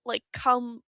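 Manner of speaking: usual speaking voice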